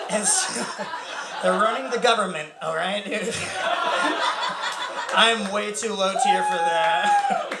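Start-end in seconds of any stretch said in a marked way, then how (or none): 3.4-5.1 breathy giggle high up; real laugh; high airy laugh. Real, not acted. Laughing at lizard joke. 5.1-7.5 laughing. Real, not acted. Laughing while joking.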